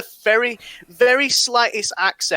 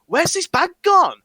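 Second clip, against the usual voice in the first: puzzled question; 10 semitones higher, 3 dB louder, slower than usual. Retold or imitated.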